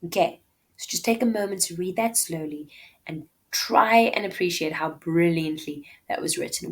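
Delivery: usual speaking voice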